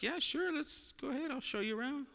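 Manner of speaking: high, bright mock-cheerful welcome; chirpy host voice. Pastor voicing himself welcoming the visitors at the door: high, chirpy and cheery. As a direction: sing it up high like an overeager host.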